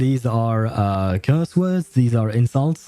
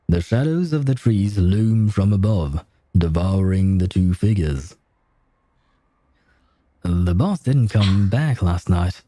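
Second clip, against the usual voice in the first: story reading; 4 semitones lower than usual. Acted. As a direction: read the game's lines like a storyteller. Reads game text aloud.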